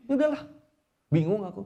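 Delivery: high, loud exasperation; acted flustered surrender; baffled wife giving up. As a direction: throw it up in mock frustration. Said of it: "bingung juga".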